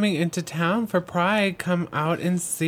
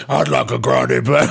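low odd voice to freak her out; deep put-on voice; 8 semitones lower than usual. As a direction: drop low to spook the barista. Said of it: "Why did you talk like that".

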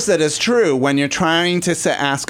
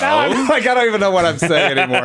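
laughing; 4 semitones higher, faster than usual. Real, not acted. Flustered after a muddled demo.

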